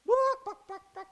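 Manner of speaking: high, squawky chicken buck-bucks to taunt; mocking chicken clucks. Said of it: "making fun of Peter".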